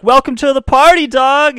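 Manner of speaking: high, loud party welcome; hype greeting. Retold or imitated. Gang regulars greeting arrivals, loud and high. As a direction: shout it like a hyped-up greeter. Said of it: "they would show up and be like".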